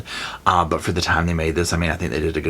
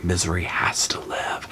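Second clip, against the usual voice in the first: whispered plea; 7 dB quieter than usual. Retold or imitated. Paul pleading with Annie.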